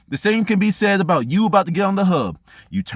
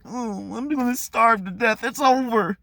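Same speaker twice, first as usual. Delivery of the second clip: softer, limp mock hopelessness; mock despair; 4 semitones higher, 4 dB quieter than usual. Person facing food shortage, limp mock despair. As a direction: play the quitter giving up. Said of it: "are you just gonna sit there and be like".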